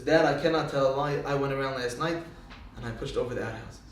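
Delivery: low, softening admission, trailing down; quiet confession. Acted. Tony Junior confesses to his father, quiet confession. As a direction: own up to Dad, voice sinking.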